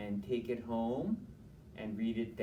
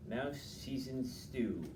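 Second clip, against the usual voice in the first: plain reading voice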